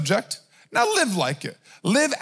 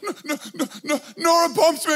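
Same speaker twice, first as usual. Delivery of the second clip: hitching, breathless mock whimper, pitched up; mock worked-up child; 4 semitones higher, faster than usual. Little son runs to dad after being bumped, mock worked-up. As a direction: imitate a gasping, tearful little boy. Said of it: "worked up".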